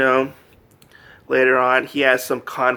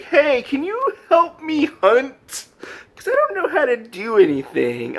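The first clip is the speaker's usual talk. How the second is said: pleading pup; 12 semitones higher than usual. Acted. Wolf asking his subjects to help him hunt, mock pleading. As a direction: whine for help in a high put-on voice.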